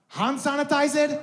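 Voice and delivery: English in a high Arabic accent. Shown as a demonstration. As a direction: ask it questioningly with an Arabic accent. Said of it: "say it with an Arabic accent".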